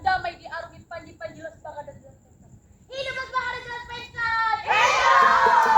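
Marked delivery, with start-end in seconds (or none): 2.9-5.7 loud rallying cry; rallying shout. Acted. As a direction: shout it like a chant.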